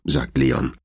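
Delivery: ordinary delivery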